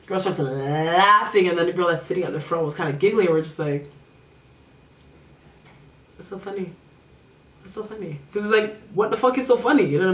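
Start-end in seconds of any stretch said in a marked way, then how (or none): 0.4-1.5 LAUGHING with a long held L at the start; drawn-out word. Real, not acted. Storyteller recalling girls laughing at them, drawn-out LAUGHING. 6.2-8.2 very quiet, under the breath; mock aside, much softer than normal speech; muttered aside. Puzzled visitor reacting to giggling spa girls, muttered aside.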